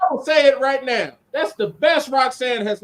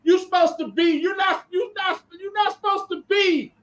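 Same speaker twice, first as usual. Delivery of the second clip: high, exasperated scolding of Booker; a higher-pitched mock rant; 8 semitones higher than usual. Co-host scolds Booker on TV, mock outrage.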